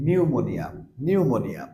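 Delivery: নিউমোনিয়া said with no p; pneumonia with silent p. Shown as a demonstration. Teacher repeats for students: নিউমোনিয়া, silent p. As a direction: model নিউমোনিয়া starting on n. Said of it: "উচ্চারণ করছি".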